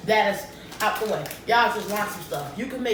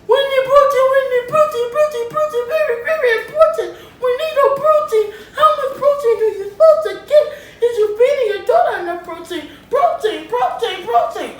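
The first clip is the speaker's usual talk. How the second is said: high, loud, sing-song mock whine; mocking nagging people; 13 semitones higher, 9 dB louder than usual. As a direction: parody everyone nagging about protein. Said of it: "People ask it so much".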